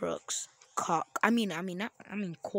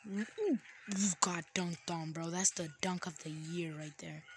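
usual speaking voice

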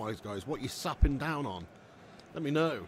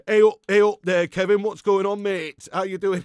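loud, higher-pitched mock Manchester accent; proper Manc accent; 6 semitones higher, 12 dB louder than usual. Perry greeting Kevin, back from Manchester, proper Manc accent. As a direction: put on a hammed-up Manc greeting. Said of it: "He comes back with a proper Manc accent".

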